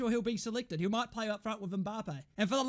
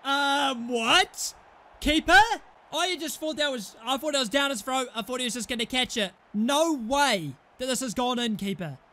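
ordinary talk

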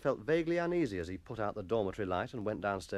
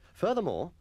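ordinary delivery

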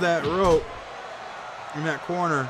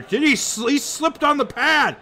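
surprised; 10 semitones higher than usual. Real, not acted. Sees wrestler slip, surprised.

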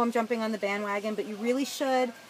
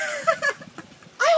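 put-on high, loud, drawn-out whiny sitcom girlfriend; Janice impression; 4 dB louder than usual. Podcaster joking to viewers as Chandler's girlfriend, high drawl. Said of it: "that might have sounded like Janice".

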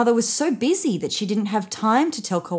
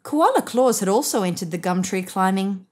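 ordinary delivery

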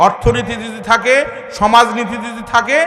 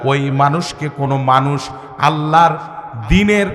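low, intoned pronouncement; solemn preaching; 6 semitones lower than usual. Acted.

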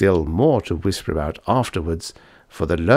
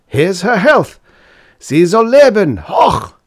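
high, loud drinking cheers; roaring roisterers; 12 semitones higher, 9 dB louder, slower than usual. A narrator's reading. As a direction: roar the toast as a student crowd. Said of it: "roared the roisterers".

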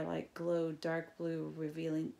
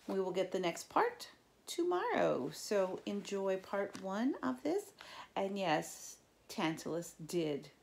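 ordinary delivery

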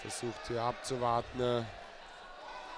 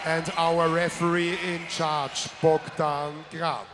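ring announcer; 4 semitones higher, 9 dB louder than usual. Acted. Ring announcer introducing referee.

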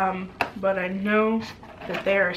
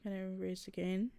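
ordinary talk, nothing marked

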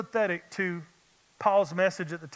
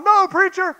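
much higher and louder call-back; congregant's answer; 12 semitones higher, 11 dB louder than usual. Retold or imitated. Voicing the congregation answering the preacher, loud and high. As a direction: shout back like someone in the pews. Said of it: "congregation answer voiced".

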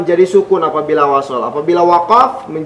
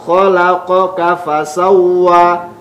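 "fasawwa" with the w doubled and pressed; slower than usual. Shown as a demonstration. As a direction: lean on the w of "fasawwa". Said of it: "Wa bertasydid". (tekankan huruf wa pada "fasawwa")

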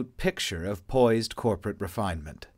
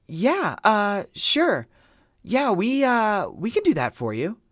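higher, dazed stammering yes; stunned agreement; 12 semitones higher, 5 dB louder, slower than usual. A narrator's reading. Ava stunned by the request, stammering higher yes. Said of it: "Ava heard her mouth say".

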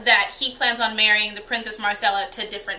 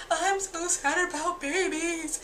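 high, slow, much softer mock pout; mock-weepy mother; 6 semitones higher, 7 dB quieter, slower than usual. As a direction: whine it like a hammed-up tearjerker. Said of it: "make her like".

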